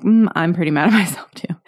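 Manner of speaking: wry whisper with a laugh; half-laughing whisper. Retold or imitated. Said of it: "And I was like".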